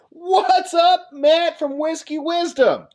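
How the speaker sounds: high, bright, drawn-out hello; excited greeting. Real, not acted. Host greeting a regular viewer on the stream, excitedly high.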